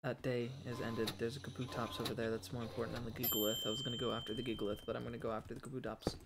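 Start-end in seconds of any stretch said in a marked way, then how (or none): 1.2-6.2 fast muttered plan. Real, not acted.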